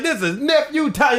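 high, slow radio prankster voice; Nephew Tommy. Retold or imitated. Prank caller naming himself in the same voice, high-pitched. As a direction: announce yourself in a put-on high voice. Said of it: "in the same voice".

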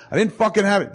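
high-pitched protest, imitated; mock indignant denial. Cornered friend denies it to roommate, mock indignant.

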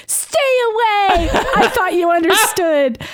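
high, drawn-out melodramatic cry; hammed-up anguish, stretching the words out; mock-dramatic outburst. Warren warning Rogue off, mock-dramatic.

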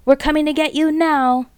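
high, loud taunt; gloating yell. A narrator's reading. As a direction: crow over the trapped fox. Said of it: "yelled Bunce".

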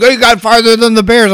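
high, loud mock protest; indignant retort. Packer fan snaps back at prank caller, indignant.

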